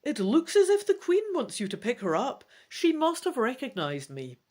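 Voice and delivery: eager character voice noticing the queen; excited elf spotting his queen; Rhinath the elf. Acted.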